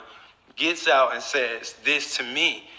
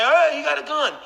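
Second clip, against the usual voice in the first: mock alarmed shout; 10 semitones higher than usual. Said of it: "He didn't scream or say".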